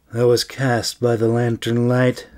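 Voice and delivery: usual speaking voice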